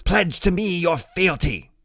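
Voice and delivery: slow, high and grand mock decree; a little boy playing emperor; pretend dark lord. Boy on his friend's shoulders demanding loyalty, mock royal decree.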